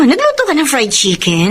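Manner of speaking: ordinary talk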